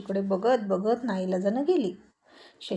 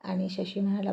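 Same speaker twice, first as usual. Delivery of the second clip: ordinary delivery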